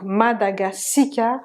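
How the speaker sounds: "Madagascar" as ma-da-ga-si-ka; "Madagascar" with a clear "si" syllable; vowel between S-K. Shown as a demonstration. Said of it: "il y a une voyelle entre le S et le K".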